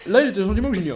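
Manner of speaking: high, loud political-figure impression; hammed-up mimicry, high and projected; Henri de Lesquen. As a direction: push the voice up into a mannered caricature. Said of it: "Henri de Lesquen".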